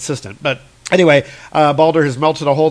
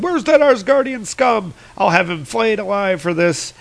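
high, panicked villain voice; Utgard Loki; 7 semitones higher than usual. Acted. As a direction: rage in a hammed-up panic at the escaped foe. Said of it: "And Utgard Loki is panicking".

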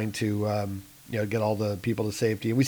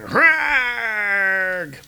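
high mock battle roar; war roar; 9 semitones higher, 3 dB louder than usual. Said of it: "he's going".

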